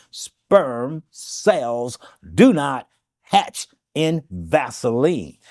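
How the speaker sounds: very slow, each word drawn out; deliberate slow repeat for emphasis; said slowly. Shown as a demonstration. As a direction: spell it out slowly so it sinks in. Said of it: "I'll say this slowly".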